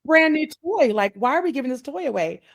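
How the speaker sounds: put-on high-pitched, incredulous kid's protest; confused child. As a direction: mimic a baffled child objecting. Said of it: "we're so confused".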